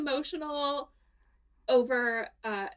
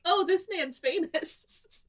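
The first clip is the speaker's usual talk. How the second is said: mock realization; 7 semitones higher, slower than usual. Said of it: "and I was like".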